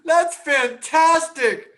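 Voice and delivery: high delighted laugh, then drawn-out praise. Real, not acted. Host reacting to the CEO car story, laughing slowly. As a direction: crack up and savour the word.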